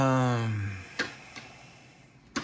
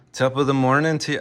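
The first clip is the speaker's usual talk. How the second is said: loud, higher stage-Irish greeting; Irish accent; 4 semitones higher, 9 dB louder than usual. Shown as a demonstration. Streamer showing off an accent he's working on, Irish. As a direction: belt out a put-on Irish accent. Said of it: "working on my Irish accent".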